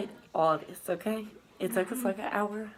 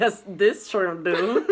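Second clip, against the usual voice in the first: laughing; 7 dB louder, slower than usual. Real, not acted. Laughing defense of claim.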